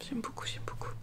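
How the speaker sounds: barely audible whisper to oneself; very soft, whispered repetition; whispered musing. Real, not acted. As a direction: murmur it under your breath, almost voiceless. Murmured repeat to herself while admiring shawl.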